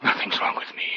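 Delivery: breathless whisper; hushed denial. Acted. David whispering to the old man that he is fine. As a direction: hiss it under your breath.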